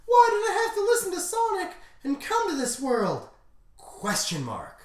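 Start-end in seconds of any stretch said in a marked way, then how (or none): 0.1-3.3 high falsetto lament; hammed-up anguished heroine, very high; Blaze. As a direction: wail the question in a squeaky voice. Blaze laments at Sonic's death.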